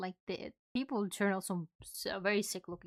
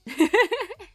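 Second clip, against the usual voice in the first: cute anime streamer; 10 semitones higher, 10 dB louder than usual. Real, not acted. High laugh.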